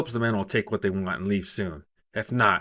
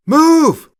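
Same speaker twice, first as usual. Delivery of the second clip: very high, very loud shouted order; yell; 15 dB louder than usual. A narrator's reading. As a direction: scream it at the top of your lungs. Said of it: "she yells".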